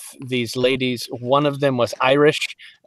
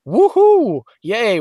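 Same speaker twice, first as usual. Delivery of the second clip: mock cheer; 8 semitones higher, 5 dB louder, slower than usual. Sarcastic cheer about weekend work.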